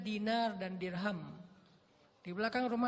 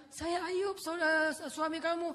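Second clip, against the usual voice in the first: higher, louder insisting voice; insistent Ayub; 7 semitones higher, 4 dB louder, slower than usual. Acted. Ayub to his doubting wife, higher insisting voice. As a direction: plead to be recognised.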